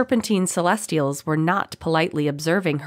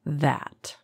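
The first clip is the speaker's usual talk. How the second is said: soft, gently placed word; hushed, gentle captor; 5 dB quieter than usual. A narrator's reading. Captor refuses his prisoner's plea, gently. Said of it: "said the voice gently".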